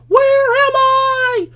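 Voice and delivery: high, long-drawn lost scream. Retold or imitated. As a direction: stretch it into one loud panicked shriek. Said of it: "That kid's still screaming".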